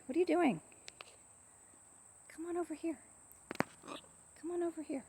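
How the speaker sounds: high, soft pet-talk voice; coaxing a pet. Real, not acted. Owner calling her bird to come over, soft high coaxing. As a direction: coax gently in a high voice.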